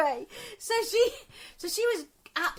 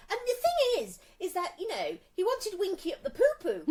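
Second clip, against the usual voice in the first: higher, louder prim lady voice; host voice; 7 semitones higher, 4 dB louder than usual. Retold or imitated. Host confiding in her young guest, higher put-on voice. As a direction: play the posh host confiding, pitched up. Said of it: "and she was like".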